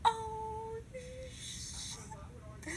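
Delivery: tiny high squeak, very soft; caught out. Retold or imitated. Caught by Jackson at the door.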